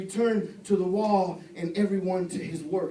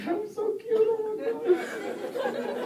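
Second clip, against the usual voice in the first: put-on high, slow cooing baby talk; cooing over cute kid; 12 semitones higher, much slower than usual. Dad melting at his silly kids, high cooing. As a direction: gush over the kid's cuteness.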